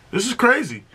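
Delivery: pitched high, bursting with excitement; can't contain how good this is; hyped up. Real, not acted. Hyped by the album.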